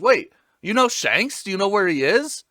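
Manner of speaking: eager excited. Retold or imitated. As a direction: jump on the name in excitement. Luffy excited about Shanks.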